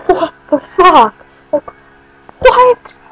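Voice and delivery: a stunned, half-crying what the fuck; stunned, rising disbelief; shocked disbelief. Retold or imitated. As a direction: voice jumping up high in disbelief on the last word. Her shocked reaction retold.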